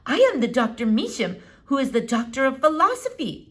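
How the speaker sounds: a hint of an old eccentric doctor; Doctor Measham. A narrator's reading. Old doctor peering at the squirrel, lightly foreign.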